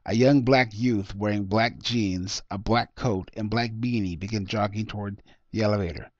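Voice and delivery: usual speaking voice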